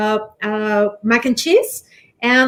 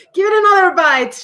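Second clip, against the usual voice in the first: laughing; 5 semitones higher, 4 dB louder, faster than usual. Real, not acted. Laughing while urging him on.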